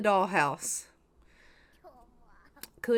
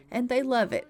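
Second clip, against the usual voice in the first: normal talking voice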